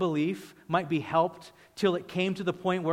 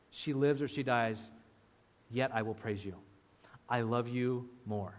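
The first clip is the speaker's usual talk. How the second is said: hushed surrender; 5 semitones lower, 5 dB quieter than usual. Acted. Quiet prayer of surrender.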